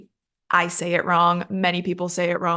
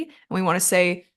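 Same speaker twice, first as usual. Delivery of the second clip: usual speaking voice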